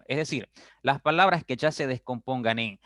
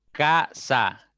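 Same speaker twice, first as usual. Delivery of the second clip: "Casa" split into ca - sa, louder and higher; syllable by syllable; 4 semitones higher, 5 dB louder than usual. Shown as a demonstration. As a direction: break "Casa" into two lifted beats, ca, sa. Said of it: "ya tienen dos sílabas".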